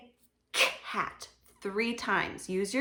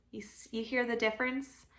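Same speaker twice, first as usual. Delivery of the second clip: normal talking voice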